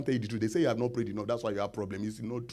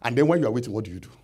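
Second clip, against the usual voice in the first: normal talking voice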